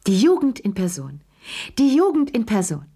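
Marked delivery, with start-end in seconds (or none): 1.8-2.8 shrieked. A narrator's reading. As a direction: squeal it out in delight. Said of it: "kreischte Madame Pelou".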